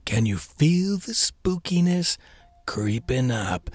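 slow, hushed, eerie opening; spooky whisper. Pretend. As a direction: creep the listener out, slow and breathy. Spooky Halloween podcast opening.

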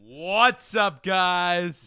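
loud, drawn-out hype greeting; stadium announcer voice. Shown as a demonstration. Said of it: "stadium announcer voice".

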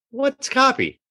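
high, puzzled question; clueless surprise. Retold or imitated. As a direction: ask like you've never heard the word. Naive question in retold dialogue.

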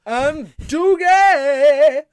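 high, loud sing-song thanks; excited roll call. Acted. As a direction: call each name like a gameshow host.

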